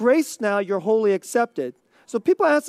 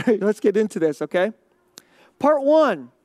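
ordinary talk

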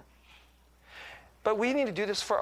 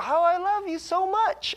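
put-on high, slow cooing to a child; doting father; 12 semitones higher, 3 dB louder than usual. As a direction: stretch the affection out way up in pitch. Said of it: "And I would go".

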